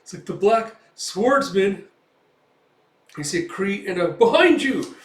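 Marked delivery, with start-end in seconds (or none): 0.2-1.9 high, loud, slow stunned repeat; shocked echo. Acted. Thug shocked by the name. 4.1-4.9 very high, loud cry; warning shout. Acted.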